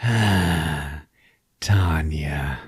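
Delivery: put-on whispered sigh of a girl's name; breathy whisper. Lovesick teen whispering his crush's name over the yearbook.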